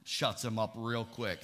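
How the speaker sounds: normal talking voice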